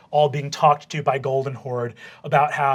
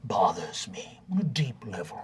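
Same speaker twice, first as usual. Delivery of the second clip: stern vice principal; 2 semitones lower than usual. Acted. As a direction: savour each word, with long menacing pauses. Vice principal lectures the students.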